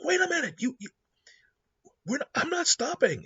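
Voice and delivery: a high-pitched "wait a minute" outburst; indignant protest. Retold or imitated. Hero protests being called off.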